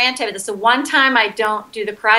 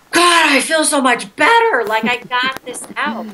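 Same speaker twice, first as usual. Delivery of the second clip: relieved client; 4 semitones higher than usual. Retold or imitated. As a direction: let it all out with a sigh of release. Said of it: "so many clients who are like".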